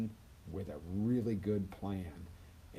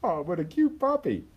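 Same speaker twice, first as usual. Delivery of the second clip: high, loud gush over a cute puppy; cooing over a puppy; 10 semitones higher, 10 dB louder than usual. Retold or imitated. Dog owner meeting a new puppy, high excited gush. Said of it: "Dog owners".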